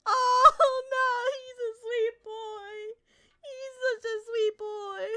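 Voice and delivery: choked up and slow, voice breaking; real tears, high and drawn out; teary gushing. Real, not acted. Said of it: "I'm gonna cry".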